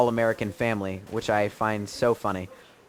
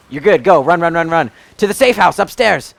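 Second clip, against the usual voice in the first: loud, higher urging to run; urgent calling out; 6 semitones higher, 8 dB louder than usual. Real, not acted. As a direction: hurry a teammate to safety. Urging teammate to flee.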